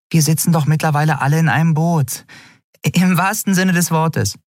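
high, quick little creature voice; small creature voice. A narrator's reading. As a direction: chirp it up high and fast. Small flying Nelen speaks. (hohe, schnelle Stimme eines kleinen Wesens)

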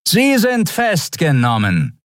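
high, drawn-out official command; Skria officer's announcement. A narrator's reading. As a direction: announce the arrest in a high, stretched voice. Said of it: "erklärte die Skria-Stimme". (hohe, gedehnte Durchsagestimme)

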